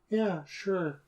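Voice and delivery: lightly voiced affectless man; flat voice. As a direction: toss it off like the flat-voiced dog handler. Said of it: "who has kind of a flat voice".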